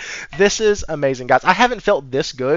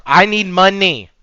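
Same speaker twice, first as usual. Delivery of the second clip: curt boss; 4 semitones higher, 7 dB louder than usual. Retold or imitated. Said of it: "Satya's like".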